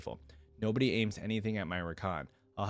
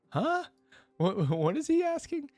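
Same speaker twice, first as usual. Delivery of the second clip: high, loud, laughing disbelief; baffled laughter; 5 dB louder than usual. Real, not acted. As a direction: crack up in real confusion, pitch shooting up. Reader baffled by the odd line.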